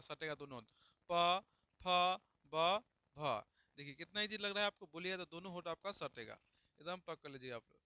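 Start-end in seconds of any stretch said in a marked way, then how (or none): 1.1-3.4 प फ ब भ popped out loudly with both lips pressed; loud lip consonants demo. Shown as a demonstration. Teacher drilling class on lip sounds, loud and exaggerated. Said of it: "प बोले तो दोनों होठ मारेगा".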